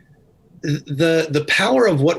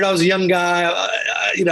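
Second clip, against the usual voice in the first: ordinary delivery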